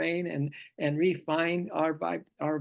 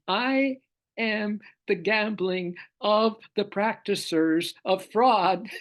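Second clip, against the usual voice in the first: slow, every word weighted, a little higher; slow emphatic reading; 4 semitones higher, 4 dB louder, slower than usual. Acted. Repeating the verse for Vinny, slow and emphatic. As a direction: lean into each word so it lands. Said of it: "No, did you hear me? Did you get that, Vinny?".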